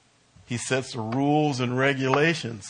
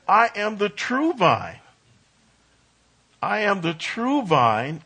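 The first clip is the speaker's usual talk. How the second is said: true drawn out and weighted; preacher's slow emphasis on true; stress on true; 7 semitones higher than usual. Shown as a demonstration. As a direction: lean on the word true, slowly. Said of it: "I underlined the word true here".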